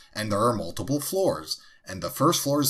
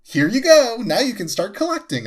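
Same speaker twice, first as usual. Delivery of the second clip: much higher, louder, sing-song handover; mock cheerful gift; 13 semitones higher, 8 dB louder than usual. Imitating Devin pushing a gift on Eric, chirpy. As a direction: chirp it like a proud giver.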